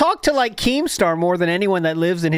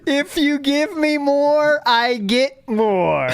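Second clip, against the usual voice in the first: slower, drawn out, each word spelled out; slow repeat; 8 semitones higher, much slower than usual. Shown as a demonstration. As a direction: stretch it out as if to a child. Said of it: "Get it?".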